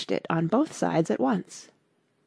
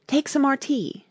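high, earnest offer; 8 semitones higher than usual. A narrator's reading. March Hare offers Alice tea, earnestly. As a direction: press the offer in a raised voice. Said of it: "said to Alice very earnestly".

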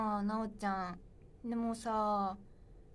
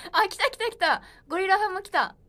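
much higher and louder; bubbling over as comments arrive; thrilled to see the gorilla fans; excited; 9 semitones higher, 10 dB louder than usual. Real, not acted.

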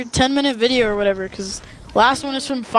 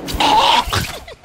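high giggle. Real, not acted. Laughing at friend.

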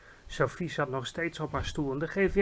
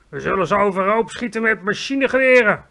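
loud, higher army-chief call; 5 semitones higher, 9 dB louder than usual. A narrator's reading. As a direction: shout it eagerly. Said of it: "riep het hoofd van het leger". (luid roepend)

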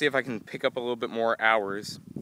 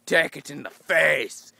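louder taunting, slowing to a drawn-out end; loud taunt stretched out slowly; retold yelling; 4 semitones higher, 7 dB louder, much slower than usual. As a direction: gloat at the opponent. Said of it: "yelling at".

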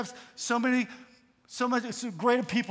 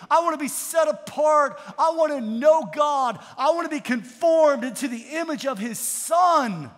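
loud, high, impassioned sermon; full-force preaching; 4 semitones higher, 7 dB louder than usual. Real, not acted. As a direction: build a rousing run of declarations from the pulpit.

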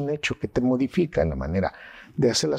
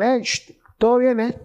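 dismissive greeting; 9 semitones higher, 5 dB louder than usual. Shown as a demonstration. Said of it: "No es lo mismo que digan".

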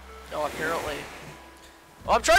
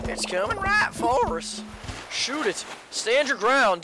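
excited; 5 semitones higher, slower than usual. Acted. Game role shouting.

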